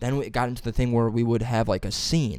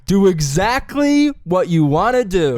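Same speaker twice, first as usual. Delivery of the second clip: loud, higher, slow coaching call; teacher's command; 8 semitones higher, 9 dB louder, slower than usual. Retold or imitated. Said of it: "And my teacher goes".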